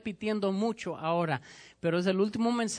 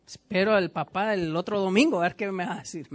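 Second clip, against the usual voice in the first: grumbling churchgoer; 3 semitones lower than usual. Pretend. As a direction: grumble like a member who dislikes the sermon. Pastor voices a displeased churchgoer.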